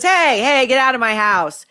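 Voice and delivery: light jokey impression of Bette; joking Bette. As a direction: tease her like a joking hostess. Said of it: "tries to defuse it by joking".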